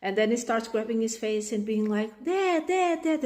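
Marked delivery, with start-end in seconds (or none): 2.2-3.2 put-on nagging child.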